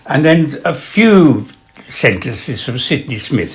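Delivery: usual speaking voice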